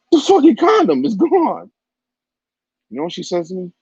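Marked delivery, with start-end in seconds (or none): 0.1-1.7 high, loud panicked falsetto; panicked shriek. Retold or imitated. Telling his partner the condom vanished, in a shrill falsetto panic. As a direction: freak out in a squeaky panic.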